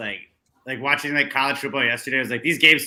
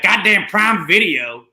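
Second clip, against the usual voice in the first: slow, loud, higher exasperated curse; mock annoyed gripe; 7 semitones higher, 7 dB louder, slower than usual. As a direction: drag out the complaint in mock outrage. Griping at streaming service.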